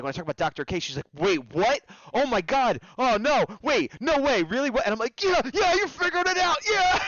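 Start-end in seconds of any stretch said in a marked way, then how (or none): 1.1-4.9 higher-pitched mock disbelief, building; rising re-enacted amazement; imitated astonishment. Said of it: "bigger explosion of emotion with her". 5.2-7.0 very high, squealed excitement; explosion of emotion. Retold or imitated. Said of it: "I had a bigger explosion of emotion".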